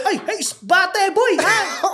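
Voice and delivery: put-on high, slow Filipino-accented shout; Tito Per's Filipino accent. Uncle carrying on shouting from the car, Filipino accent. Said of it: "In his funny little Filipino accent".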